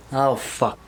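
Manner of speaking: ordinary delivery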